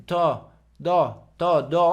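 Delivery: "tó, dó" rounded and light on the tongue; easy pouted "tó, dó"; light pouted syllables. Shown as a demonstration. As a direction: say "tó, dó" through pursed French lips. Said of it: "E olha como isso é leve".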